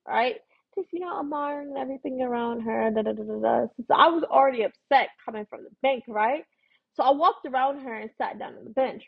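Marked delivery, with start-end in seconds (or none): none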